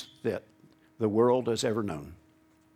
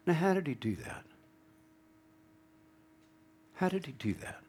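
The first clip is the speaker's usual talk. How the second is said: hushed wonder; 8 semitones higher, 8 dB quieter, faster than usual. Acted. Rhetorical question to congregation.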